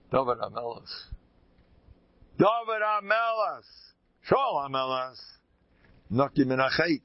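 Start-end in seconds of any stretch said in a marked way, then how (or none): 2.4-5.2 proclaiming. Shown as a demonstration. Proclaims the kings' names.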